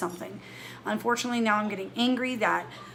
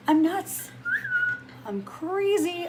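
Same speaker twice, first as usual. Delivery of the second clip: higher, slow self-mocking singsong; mock crazy; 10 semitones higher, much slower than usual. Mocking her own anxiety to viewers, higher and slow. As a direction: playfully call yourself crazy.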